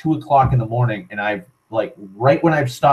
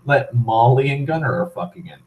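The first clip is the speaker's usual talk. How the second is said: usual speaking voice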